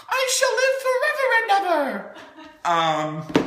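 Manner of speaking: grand mock proclamation. Joking to viewers about turning out fine, grand mock proclamation. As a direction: proclaim it like a melodrama heroine.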